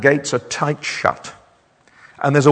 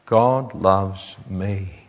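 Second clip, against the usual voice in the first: slow, soft and low, savouring each word; tender; 5 semitones lower, 4 dB quieter, much slower than usual. Real, not acted. Preacher quietly tells the congregation, slow and tender. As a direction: say it quietly, as a personal wonder.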